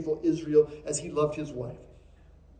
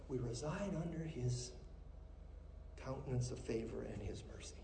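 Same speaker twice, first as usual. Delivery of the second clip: hushed; 4 semitones lower, 20 dB quieter than usual. Acted. Hushed sermon moment.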